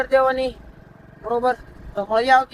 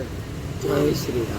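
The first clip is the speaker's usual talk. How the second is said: whispered prompt; 7 semitones lower, 4 dB quieter, much slower than usual. Real, not acted. Father softly prompting his son to chant the greeting, whispered. Prompting child after "say it loud.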